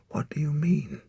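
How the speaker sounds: put-on a boy whispering in fear; whispered question. A narrator's reading. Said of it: "he whispered".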